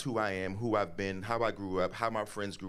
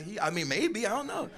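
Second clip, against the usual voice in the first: flustered dodge; 13 semitones higher than usual. Retold or imitated. His flustered answer.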